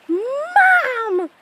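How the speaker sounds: high, loud, drawn-out "Mom!"; excited call. Retold or imitated. Teller replays calling her mom over, excited holler. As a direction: holler for your mother on a long high note.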